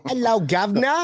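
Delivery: high-pitched mock British greeting; British accent. Shown as a demonstration. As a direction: ham up a bad British accent. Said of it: "That's his British accent".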